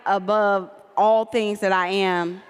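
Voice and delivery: ordinary delivery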